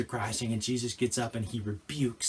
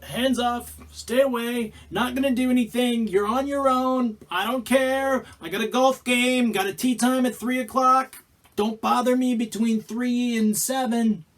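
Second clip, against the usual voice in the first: higher, dismissive, can't-be-bothered voice; indifferent god; 9 semitones higher, 5 dB louder than usual. Retold or imitated. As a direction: brush them off for golf. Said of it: "a God who ... is like".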